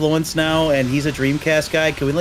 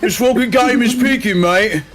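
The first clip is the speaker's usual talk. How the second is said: mock Aussie; 5 semitones higher than usual. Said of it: "continuing the mock accent".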